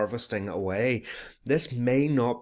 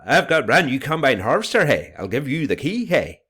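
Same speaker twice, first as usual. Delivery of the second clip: loud, high, fast County Antrim lilt with a tacked-on hey; County Antrim accent; 6 semitones higher, 8 dB louder than usual. Shown as a demonstration. Teacher redoing the song lyric in a County Antrim accent. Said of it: "a wee County Antrim accent".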